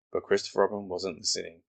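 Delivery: ordinary delivery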